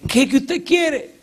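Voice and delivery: high, strained voice begging to be let go; a cornered man whining to get past; bandit pleading. Retold or imitated. Bandit begs the pastor to move, high and strained.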